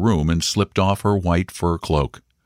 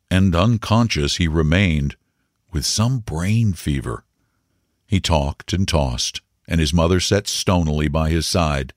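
ordinary delivery

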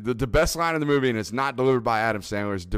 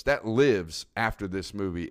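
normal talking voice

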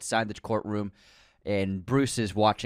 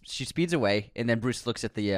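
ordinary delivery

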